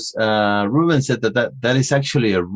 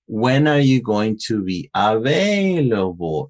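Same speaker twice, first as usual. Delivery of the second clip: slow demo, AVAILABLE swooping up then falling; up-and-down question intonation. Shown as a demonstration. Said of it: "that's how the intonation, uh, uh, goes up".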